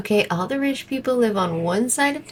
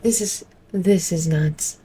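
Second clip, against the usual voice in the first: ordinary talk, nothing marked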